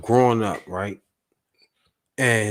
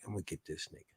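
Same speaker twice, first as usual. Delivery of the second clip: muttered vow; 6 semitones lower, 24 dB quieter, much faster than usual. Retold or imitated. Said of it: "the child is just sitting there like".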